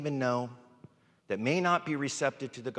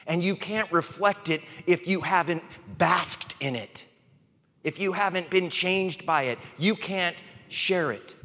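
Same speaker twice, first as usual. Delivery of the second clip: higher and louder, ringing pulpit preaching; preaching at full force; 6 semitones higher, 5 dB louder than usual. Real, not acted. Pastor urges the church to be honest with God, preaching hard. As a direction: lift the room with a passionate sermon.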